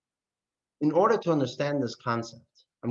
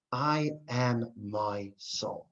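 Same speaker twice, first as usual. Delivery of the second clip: tender; 4 semitones lower, 5 dB quieter, much slower than usual. Real, not acted. Soft, very slow answer.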